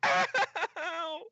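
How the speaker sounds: very high drawn-out "ow"; yell of pain. Acted. Helper hurt under the table, high pained yell. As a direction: yelp in mock agony. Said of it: "the yell of pain".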